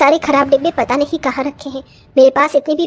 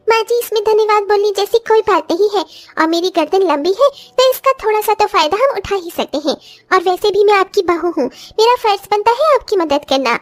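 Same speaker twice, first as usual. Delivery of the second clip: higher, sweet bahu voice; high voice of a young daughter-in-law offering help; 6 semitones higher than usual. Acted. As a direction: lift the voice into an eager young bride.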